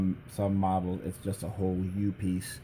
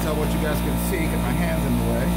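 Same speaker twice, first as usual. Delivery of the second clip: raised voice over the compressor; loud over shop noise; 6 semitones higher, 5 dB louder than usual. Real, not acted. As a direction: speak up over the racket. Talking over noisy shop compressor.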